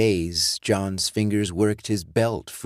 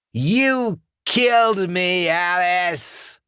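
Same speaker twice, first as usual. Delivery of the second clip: much higher and louder, slow furious roar; raging undead John; 12 semitones higher, 4 dB louder, much slower than usual. Acted. Dead John raging at Alice, furious roar. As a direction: roar it in fury.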